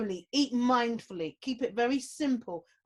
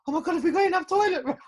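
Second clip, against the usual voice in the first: fast, higher and louder, in a sudden panic; panicked; 7 semitones higher, 6 dB louder, faster than usual. Retold or imitated. Wife suddenly panicking to her husband about toilet roll, fast and loud. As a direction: blurt it out like the stress just hit you. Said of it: "I turned to my husband and went".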